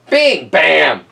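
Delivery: plain reading voice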